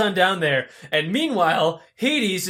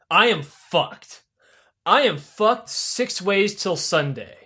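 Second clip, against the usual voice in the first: grave admission; slower than usual. Acted. Sisyphus confides grimly in his wife.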